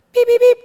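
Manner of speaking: high, loud electronic beeps; imitated alarm beeps. Detector going off at two in the morning, shrill mimicked beeps.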